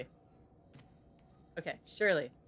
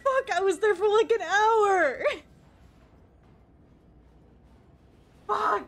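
crying; 9 semitones higher, 3 dB louder than usual. Real, not acted. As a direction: wail it through tears. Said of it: "I'm actually crying".

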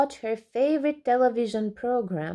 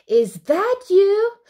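calling out; 11 semitones higher, 9 dB louder, slower than usual. A narrator's reading. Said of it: "came Mary's voice".

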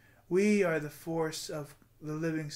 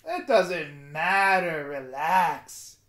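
calm reassurance; 7 dB louder, much slower than usual. Acted. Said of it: "calming advice after mock whine".

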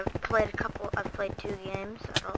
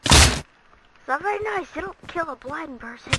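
high-pitched complaint; high, indignant gamer whine; whining protest; 8 semitones higher, 9 dB louder than usual. Real, not acted. Protesting being killed while blind.

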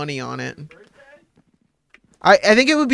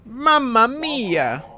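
put-on Italian accent; 5 semitones higher, 3 dB quieter, much slower than usual. Italian chef bit.

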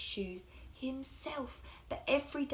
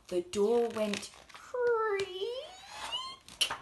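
very slow, high creak; slow creepy; 11 semitones higher, much slower than usual. A narrator's reading. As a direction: stretch the creak out. Spooky midnight.

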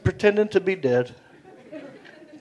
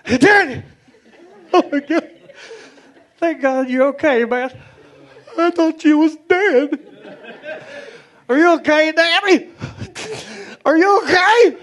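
relieved excitement; 9 semitones higher than usual. Retold or imitated. Relieved kid greeting his friend, high excited. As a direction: gush like he's back from the dead. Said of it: "I was like".

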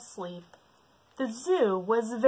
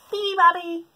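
high, loud cheerful chirp; 11 semitones higher, 8 dB louder than usual. Acted. Bird settles for a nap, cheerful. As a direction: chirp it happily. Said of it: "said the baby Beebe bird cheerfully".

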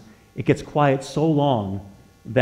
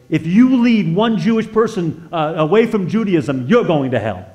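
imitating an angry woman, higher and louder, slow at first; mock threat of hell, louder; woman's warning; 5 semitones higher, 5 dB louder than usual. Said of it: "and she goes".

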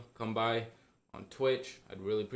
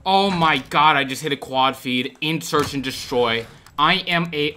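loud, high elated outburst; can't contain the hype; freaking out; 3 semitones higher, 9 dB louder than usual. Real, not acted. Player celebrating a quad feed, loud and elated. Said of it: "Why was I freaking out so much?".

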